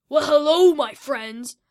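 loud, sly mocking greeting; gloating villain. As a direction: taunt them as you block their path.

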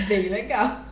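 laughing. Real, not acted. Laughs while reading.

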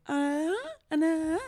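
high, halting stand-up voice; nervous ums up on stage; old stiff stage voice. Retold or imitated. Comic recalling her early stage act in a high, hesitant voice. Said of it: "which is not how I talk at all".

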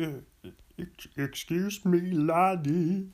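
a celebrity's hammed-up attempt at British; Charles Barkley. Sports celebrity addressing a laddie, terrible British accent. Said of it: "Charles Barkley doing a British accent".

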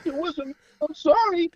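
high, mock-innocent excuse; playing stupid. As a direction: act the clueless fan caught out, voice up high. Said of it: "I'm playing stupid".

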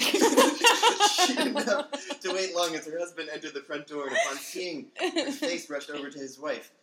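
giggly reading; reading through laughter; laughing while reading. Real, not acted.

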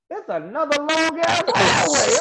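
exasperated. Real, not acted. Frustrated by long walk.

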